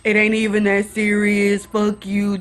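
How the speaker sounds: imitated guy backing off with bravado; mock a guy cussing as he retreats; typical guy. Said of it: "does the typical guy thing".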